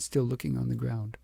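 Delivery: usual speaking voice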